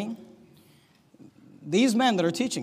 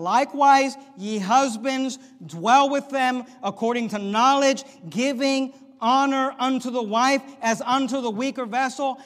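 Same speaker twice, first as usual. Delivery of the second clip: shouted, much higher scripture, slow and stretched; fiery scripture reading; 8 semitones higher, 9 dB louder, slower than usual. Acted. Preacher shouting the verse to the husbands in the pews. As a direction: thunder the verse out over the congregation. Reads scripture at full force.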